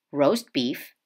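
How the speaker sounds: plain reading voice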